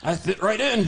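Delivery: loud puppet voice; chirpy puppet boast. Acted. Puppet answers.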